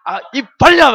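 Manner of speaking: hammed-up command to open up; forceful shout. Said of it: "막 하면서 막 집어넣어야 되죠?".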